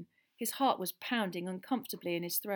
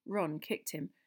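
ordinary delivery

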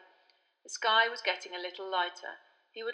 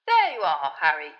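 louder man's greeting; a voice from behind; 3 semitones lower, 9 dB louder than usual. A narrator's reading. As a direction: call out to him from behind. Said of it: "said a voice".

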